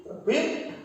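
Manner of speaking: usual speaking voice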